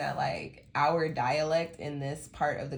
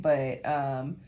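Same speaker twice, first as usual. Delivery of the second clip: normal talking voice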